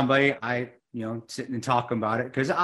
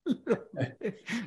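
breathy laugh, higher and softer; 9 semitones higher, 8 dB quieter than usual. Real, not acted. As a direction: let a real laugh slip out. Laughing at own story.

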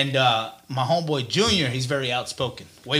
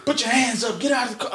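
cop command; 9 semitones higher than usual. Retold or imitated. Cops drawing guns.

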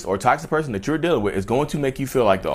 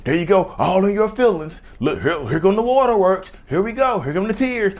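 mocking taunt; 6 semitones higher than usual. Mocking narcissist taunts crier.

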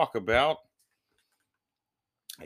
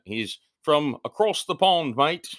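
put-on higher, louder, drawn-out British accent; hammed-up Brit, pitched up; mock British accent; 7 semitones higher, 6 dB louder than usual. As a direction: playfully overdo a British accent. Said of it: "why I said it in an accent".

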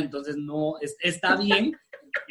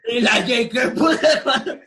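higher, laughing crowd heckle; half-laughing heckle in a put-on voice; mock crowd demand; 5 semitones higher, 3 dB louder than usual. Friends retelling a concert, mock heckling for the frog song, laughing.

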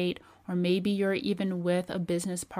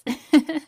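loud laugh; 8 semitones higher, 10 dB louder than usual. Real, not acted. Laughs at own joke.

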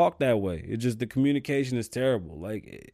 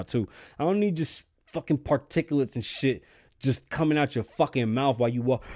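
ordinary talk, nothing marked

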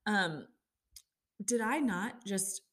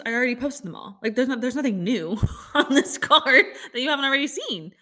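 usual speaking voice

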